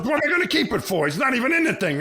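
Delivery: high and fast, brushing it off; mock scoffing old man who doesn't see the point; dismissive father-in-law. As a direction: toss it away like it's worthless, hammed up. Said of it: "and he goes".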